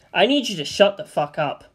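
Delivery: ordinary delivery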